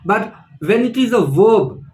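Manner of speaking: ordinary talk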